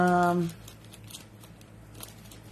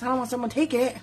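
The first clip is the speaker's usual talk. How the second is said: ordinary delivery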